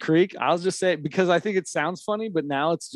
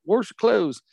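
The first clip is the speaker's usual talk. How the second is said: put-on hillbilly accent; 5 semitones higher than usual. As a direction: call them down to the creek in a hillbilly accent. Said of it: "if somebody has an accent like that".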